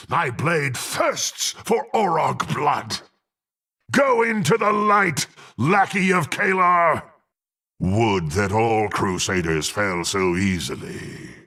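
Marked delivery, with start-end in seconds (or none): 3.9-7.0 high, commanding war cry; triumphant battle shout. Acted. Warrior striking down a lackey of Kalar, fierce shout.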